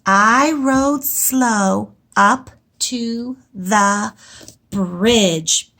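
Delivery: very slow, word by word reread. Shown as a demonstration. Teacher rereads her sentence to the class. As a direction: point and read each word slowly. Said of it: "let's go back and reread".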